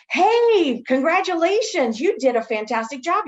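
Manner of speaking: high, loud bright congratulation; sunny mock praise to a nurse; cheery praise. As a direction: beam at them as you praise. Praising staff, bright greeting.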